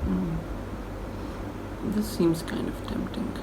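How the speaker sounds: soft breathy whisper. Real, not acted. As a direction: whisper it to yourself. Quiet aside choosing dessert.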